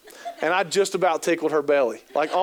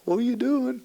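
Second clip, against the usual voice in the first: mock bewildered; 7 semitones higher than usual. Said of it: "You're like".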